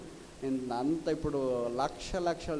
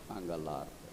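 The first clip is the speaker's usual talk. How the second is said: hushed emphasis; 11 semitones lower, 8 dB quieter than usual. Acted. Climax word after contrast.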